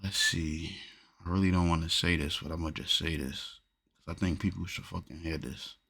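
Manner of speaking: hesitant, hushed lead-in; reluctant confession. Real, not acted. Reluctant to say this but people should hear it, hushed and hesitant. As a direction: say it softly, unsure about sharing.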